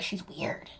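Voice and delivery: hushed aside, barely voiced; whispered judgement. People secretly judging her, gossipy whisper.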